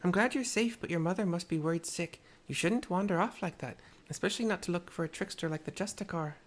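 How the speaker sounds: put-on Tepeke's mixed accent. Tepeke scolds the wandering girl in a mixed accent. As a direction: scold the child gently in a put-on foreign accent. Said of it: "the accent I've chosen for this video for her".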